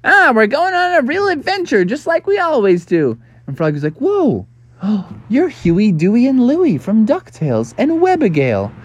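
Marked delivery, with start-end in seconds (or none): 0.0-3.2 high cartoon duckling voice; little duck kids. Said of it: "three little boy ducks and one little girl duck". 4.0-8.7 high amazed 'whoa' and a gasp; starstruck surprise. Acted. Frog meets his heroes.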